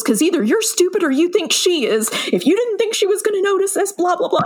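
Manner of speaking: high-pitched imitation of a telling-off; retold dressing-down. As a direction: tear into the student in mock outrage. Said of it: "he lights this child up".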